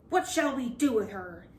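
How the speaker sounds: plain reading voice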